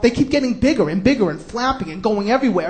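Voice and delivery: usual speaking voice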